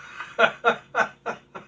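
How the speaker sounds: high laugh at own joke. Real, not acted. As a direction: burst of laughter after the punchline.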